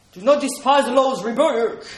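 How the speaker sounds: loud, booming stern voice of a harsh God; mock-harsh thundering scripture quote; harsh stern rebuke. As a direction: bark it out like a severe judge. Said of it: "he always sound a little bit harsh".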